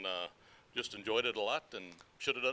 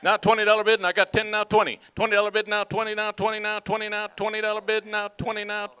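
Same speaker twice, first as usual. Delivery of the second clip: louder rhythmic auction chant; auctioneer; 6 semitones higher, 14 dB louder than usual. Acted. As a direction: roll the numbers in a chant. Auction chant, third item.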